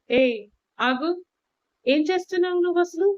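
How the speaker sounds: a lioness yelling stop, pitch climbing; mock-fierce narrated yell, rising at the end; fierce shout. A narrator's reading. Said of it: "సింహంలా గట్టిగా అరుస్తూ".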